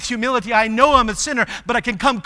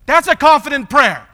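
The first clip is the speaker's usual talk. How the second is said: high, loud proclamation; emphatic declaration; 8 semitones higher, 8 dB louder than usual. Real, not acted. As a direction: proclaim it forcefully from the pulpit. Emphatic declaration after the prayer.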